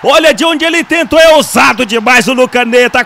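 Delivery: high shouted call; excited commentary. Real, not acted. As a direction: shout at the bold shot. Long-range shot call.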